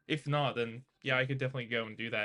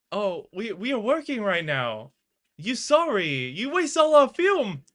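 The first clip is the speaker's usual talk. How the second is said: high, loud mock Jackie Chan accent, broken English; hammed-up Chinese accent, climbing into a yell; 8 semitones higher, 11 dB louder, slower than usual. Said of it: "That was my Jackie Chan accent".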